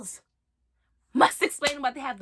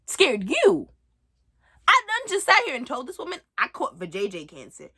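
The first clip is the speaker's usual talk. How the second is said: ordinary talk, nothing marked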